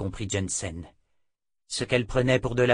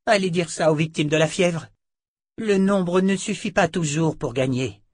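higher, insistent comeback; lightly voiced sorceress retorting; 8 semitones higher, 5 dB louder than usual. A narrator's reading. Sorceress retorting about victims of the fever, insistent. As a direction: argue back in the sorceress's voice.

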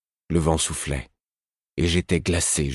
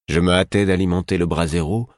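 ordinary delivery

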